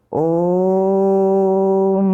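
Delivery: om held long and steady, chanted; long drawn-out om. Shown as a demonstration. Teacher to students, showing a pluta vowel: long drawn-out om. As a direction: stretch ओम into one long held tone. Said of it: "ओम का उच्चारण जो है लंबा होता है".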